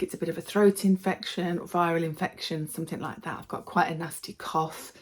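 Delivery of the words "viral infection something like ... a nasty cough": ordinary talk, nothing marked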